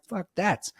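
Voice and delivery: high, scornful fuck that; scoffing dismissal. Retold or imitated. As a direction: brush the idea off with a high scoff. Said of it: "So I was like".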